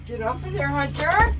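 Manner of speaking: loud call, name shouted; yelling. Real, not acted. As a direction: yell at him to get off. Sibling yelling at brother.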